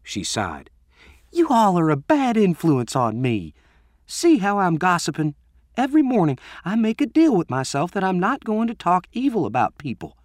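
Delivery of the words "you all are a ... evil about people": put-on teen girl voice. A narrator's reading. Leandra's voice, higher and far.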